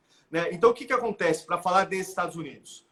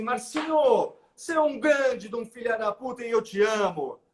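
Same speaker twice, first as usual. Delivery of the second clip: playful, higher-pitched affectionate ribbing; affectionate mock insult; 5 semitones higher than usual. Shown as a demonstration. Friend teasing his honorary older brother, affectionate insult. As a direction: curse a close friend fondly, pitched up. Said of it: "Eu posso virar pra você e falar assim".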